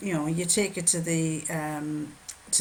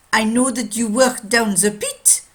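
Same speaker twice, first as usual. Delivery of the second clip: put-on loud, higher French-accented watchmaker; 4 semitones higher, 9 dB louder than usual. Watchmaker telling a miner his job, French accent. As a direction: state the deduction in a French accent. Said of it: "a French watchmaker".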